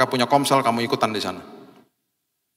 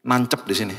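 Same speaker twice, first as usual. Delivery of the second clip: ordinary talk, nothing marked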